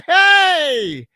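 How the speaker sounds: high, loud whoop of excitement; excited cheer. Real, not acted. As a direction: cheer it out like a party host. Hyping his karaoke night.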